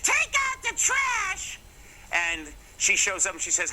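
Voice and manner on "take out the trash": very high, drawn-out mock-mom holler; mom yelling downstairs. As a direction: holler it like a mother calling down the stairs. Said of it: "the same mother who a few years earlier had said".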